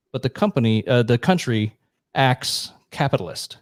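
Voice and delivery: ordinary delivery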